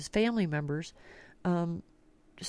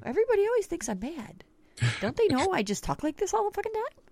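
mock indignant; 11 semitones higher, faster than usual. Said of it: "I'm like".

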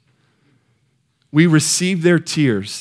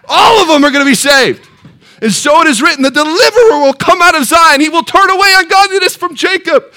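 loud, high, exultant proclamation; full-force preaching; 9 semitones higher, 8 dB louder than usual. Real, not acted. Preacher promising the congregation all will be saved, full-force preaching.

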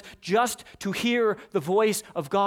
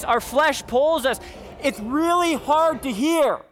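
louder, higher, driving; forceful preaching; 5 semitones higher, 6 dB louder than usual. Acted. Pastor preaching to his church about life's noise, loud and intense. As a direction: build it up like the noise of the world.

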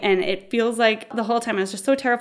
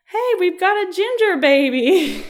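high, loud imitated nurse; excited mock nurse cheer; delighted announcement; 10 semitones higher, 5 dB louder than usual. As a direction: announce the newborn to the room.

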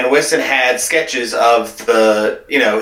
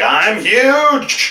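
high shouted monster brag; booming creature boast; 10 semitones higher than usual. Retold or imitated. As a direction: puff up and bellow it. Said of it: "You'd be like".

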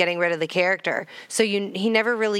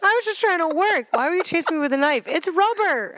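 much higher, hammed-up clown; 8 semitones higher than usual. Clown defending himself to the kids, high put-on voice. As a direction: play the clown, all innocent and squeaky. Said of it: "we're just making it up".

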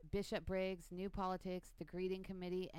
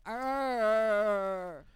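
zombie growl; 3 semitones higher, 12 dB louder than usual. Pretend. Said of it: "They go".

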